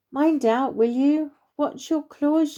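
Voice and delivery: a touch of indignant scolding; mild protest. Acted. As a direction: complain at someone clambering over you. Badger protests being clawed underfoot.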